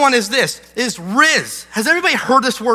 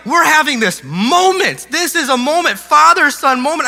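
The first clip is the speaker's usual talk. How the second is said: mock insistent; 6 semitones higher, 4 dB louder than usual. Dad insists on the moment.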